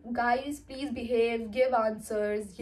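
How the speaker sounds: very slow, prissy plea; the goody classmate scolding everyone in chat, very slow prim; prim goody girl. Retold or imitated. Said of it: "comment box में लिखेगी".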